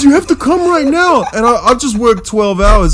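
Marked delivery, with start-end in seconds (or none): 0.0-1.2 high, pressing demand; imitating a frantic mate begging; urgent plea. Mate urging Seth to come now, high and frantic. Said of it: "He goes".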